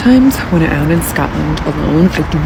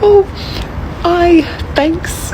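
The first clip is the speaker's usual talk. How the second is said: put-on light, half-hearted Scottish accent on a quick thanks; a faint mock Scottish lilt; Scottish twang; 10 semitones higher, much slower than usual. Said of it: "put in, like, a little Scottish twang".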